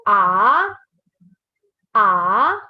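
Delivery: "A" gliding up from low to high; rising tone. Shown as a demonstration. Teacher demonstrates the second tone, rising "A". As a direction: draw out "A" with a strong rise. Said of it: "go from low to high".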